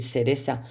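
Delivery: "seresa" por cereza, con dos eses; seseo andaluz. Shown as a demonstration. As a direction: di "seresa" seseando, s donde iría z. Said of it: "el seseo y el ceceo". ("seresa" con seseo, sin sonido z)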